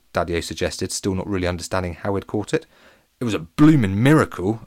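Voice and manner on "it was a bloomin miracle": plain reading voice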